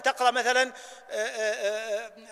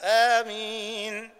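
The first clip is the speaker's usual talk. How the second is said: "آمين" said slowly after a clear silence; pause before amen; much slower than usual. Shown as a demonstration. Teacher shows the pause between "الضالين" and "آمين". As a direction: leave a pause, then say "آمين". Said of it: "تسكت بين".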